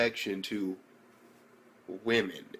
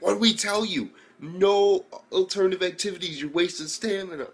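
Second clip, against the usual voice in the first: higher, raised voice telling him off; scolding coach; 8 semitones higher, 3 dB louder than usual. Acted. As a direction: bark at the fighter. Trainer scolding the boxer.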